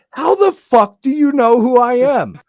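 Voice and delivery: imitating a confused friend; baffled Joe. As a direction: drag it out in disbelief. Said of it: "he's like".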